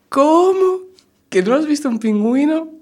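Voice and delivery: high, loud, incredulous squeal; shocked disbelief. Acted. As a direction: gasp it out as if it can't be true. Shocked director in the joke.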